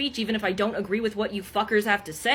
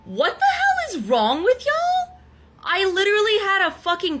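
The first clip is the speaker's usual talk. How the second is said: outraged; 7 semitones higher than usual. Real, not acted. Berating commenters who said fake crying, outraged. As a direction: hurl the question, pitch leaping high. Angry rebuke of accusing commenters.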